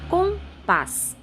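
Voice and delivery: Compás in two drawn-out syllables, high then falling; stress on last syllable. Shown as a demonstration. Teacher shows the strong syllable to students, Compás carefully split. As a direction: sound out Compás slowly, syllable by syllable. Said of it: "qual é a sílaba mais forte".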